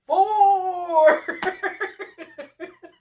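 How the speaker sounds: high loud burst of laughter; big laugh. Real, not acted. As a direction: laugh out in disbelief. Laughing at his lie.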